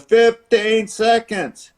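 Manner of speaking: high, drawn-out time call; ring announcer. Retold or imitated. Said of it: "the announcer goes".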